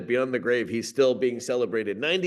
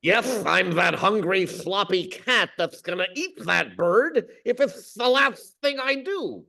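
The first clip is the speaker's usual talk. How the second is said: high, slow cartoon cat; exaggerated cat character voice, climbing at the end; Sylvester; 6 semitones higher than usual. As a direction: play a hungry cartoon cat, pitched up. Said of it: "Sylvester, is he in there?".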